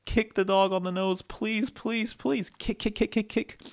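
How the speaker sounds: high, climbing urgent chant; high-pitched, insistent repetition; all-out effort. Acted. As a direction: plead through every kick, pitched right up. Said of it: "He kicked with all of his life".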